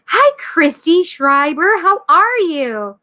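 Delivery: singled-out cheery hail, words stretched; delighted greeting. Real, not acted. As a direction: greet a viewer with stretched-out enthusiasm. Greeting a viewer in the chat.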